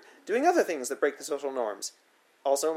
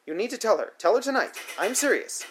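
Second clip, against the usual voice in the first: stern order; 7 semitones higher than usual. A narrator's reading. Sitter ordering the child.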